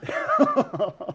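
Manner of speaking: loud, high burst of laughter; real laugh. Real, not acted. Laughing at the memory of the scratched ear. Cracking up at the story.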